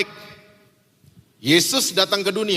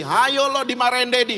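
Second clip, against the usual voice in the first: high, chanted sibling taunt; teasing intimidation; 7 semitones higher than usual. Retold or imitated. Older sister threatening brother with Daddy, teasing. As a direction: imitate a child's taunt, higher and sing-song. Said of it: "kakaknya intimidasi lagi".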